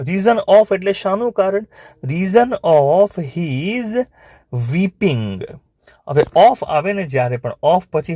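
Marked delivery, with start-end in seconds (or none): 2.0-5.4 drawn-out words with a pause before the last; slow dictation. Shown as a demonstration. Teacher dictating why the woodcutter wept, slow dictation. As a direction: spell it out word by word, very slowly.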